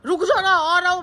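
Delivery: calling up. Retold or imitated. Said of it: "मैंने बोला".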